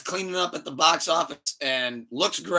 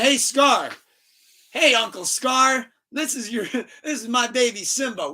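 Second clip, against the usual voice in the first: high, cheery showing-off voice; hammed-up happy announcement; mock proud greeting; 6 semitones higher than usual. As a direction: imitate an oblivious friend proudly presenting a baby. Mufasa shows off baby, retold.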